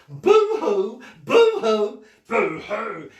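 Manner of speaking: loud, high mock wailing; over-the-top fake sobbing; hammed-up boo-hoo crying. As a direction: bawl it like a pantomime lady. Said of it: "She started to scream like this".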